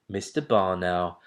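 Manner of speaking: ordinary delivery, nothing marked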